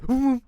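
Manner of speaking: high, loud vroom; vroom sound. Retold or imitated. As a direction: make a zooming car noise. Imitating car speeding by.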